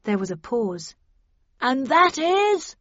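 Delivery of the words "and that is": high, slow, loud questioning call; bullhorn reply. A narrator's reading. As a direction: ask back as through a bullhorn. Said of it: "came the voice on the bullhorn".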